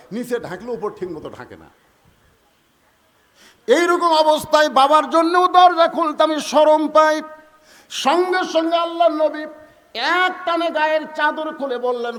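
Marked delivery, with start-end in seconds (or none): 3.7-7.2 loud, high-pitched plea from a daughter; distressed protest. Acted. Daughter too ashamed to open the door, loud distressed protest. 7.9-12.2 high, loud dramatic narration; full-force preaching. A narrator's reading. Preacher telling of the Prophet's quick act, full-force high narration.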